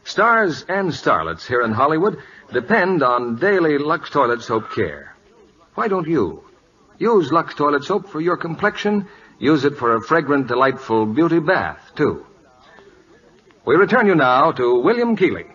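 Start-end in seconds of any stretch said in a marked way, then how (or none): none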